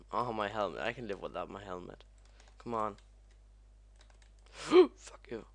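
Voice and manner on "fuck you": high, loud gasp, then a curse; startled swearing. Real, not acted. Gamer caught by a hazard, startled cursing.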